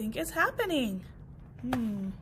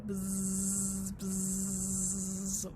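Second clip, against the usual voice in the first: low buzzing lawnmower noise; 7 semitones lower, 4 dB quieter than usual. Acted. Mower approaching the grass, low buzzing. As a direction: buzz like a mower.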